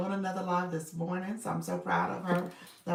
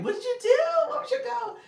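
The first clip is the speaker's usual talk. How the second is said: excited question; 7 dB louder, faster than usual. Real, not acted. Viewer says goal was met.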